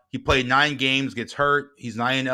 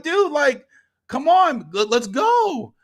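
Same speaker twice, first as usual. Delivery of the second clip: very high, loud impatient plea; retold exasperated outburst; pissed-off urging; 14 semitones higher, 6 dB louder, slower than usual. Dad pushing his contacts to act, pissed off. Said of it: "I'm a little pissed".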